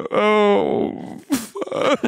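high cracked crying curse; hammed-up weepy oh fuck; mock sobbing. Imitating the sobbing passenger after turbulence. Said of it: "He's like".

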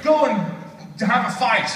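high, emphatic mock outburst; scolding dad. Dad sending son away from games, exasperated and high. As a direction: order the boy out in exasperated bursts.

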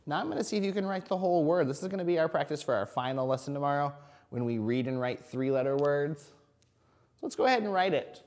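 cheerful kindergarten teacher; reading to a toddler. Acted. Teacher enthusiasm to young children.

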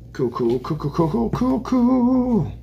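singsong cool building up high; rising cools. Real, not acted. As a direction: chant cool, rising each time.

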